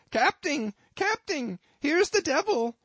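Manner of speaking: very high, loud alarm; high-pitched tattling holler; bumpkin's yell. A narrator's reading. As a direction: cry out for help in a squealing bumpkin voice. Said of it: "yelled the bumpkin".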